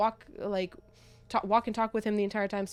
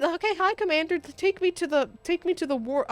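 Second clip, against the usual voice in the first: high, eager mock request; pretend coaxing; 8 semitones higher than usual. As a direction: stammer out a chirpy greeting to the commander. Acting out an imagined plea to commander.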